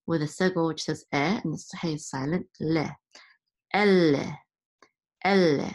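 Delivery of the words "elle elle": "Elle" with a long doubled L; loud, deliberate "elle" with the L held. Shown as a demonstration. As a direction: linger on the L in "elle". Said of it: "means to hold the lamed sound".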